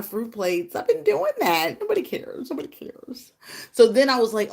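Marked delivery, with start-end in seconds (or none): 1.8-2.5 self-mocking aside. Real, not acted. 2.5-3.3 soft unvoiced nobody cares; whispered aside. Real, not acted. To herself after sharing, whispered self-mockery.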